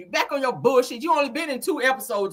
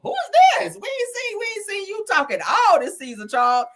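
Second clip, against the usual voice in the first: much higher shout of disbelief; mock astonished shout; 10 semitones higher, 3 dB louder than usual. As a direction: act stunned that he finally spoke. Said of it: "I was like".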